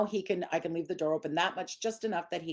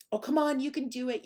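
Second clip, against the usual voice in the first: higher, eager coaxing; mock cheerleading; 7 semitones higher than usual. Shown as a demonstration. Trainer demonstrating how owners cheerlead a dog, eager. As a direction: cheer the dog on, a bit overdone. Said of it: "You don't want to cheerlead".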